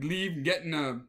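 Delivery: normal talking voice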